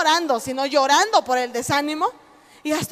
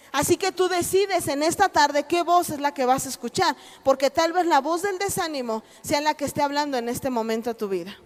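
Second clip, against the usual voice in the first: ordinary delivery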